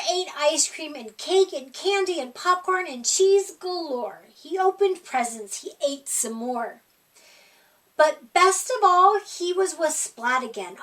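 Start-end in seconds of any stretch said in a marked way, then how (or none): none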